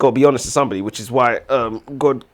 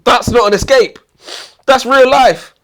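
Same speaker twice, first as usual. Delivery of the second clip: higher, louder exasperated protest; indignant rant; 12 semitones higher, 9 dB louder, slower than usual. Real, not acted. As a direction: complain hard about the ending. Ranting about book ending.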